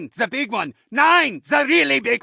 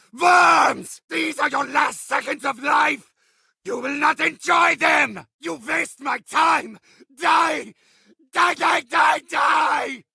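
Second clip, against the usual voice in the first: higher, shouting villain rage, spitting every threat; furious ranting villain; 3 semitones higher than usual. Acted. Villain raging at players who keep surviving, furious shouting. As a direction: scream threats at the worms who won't die.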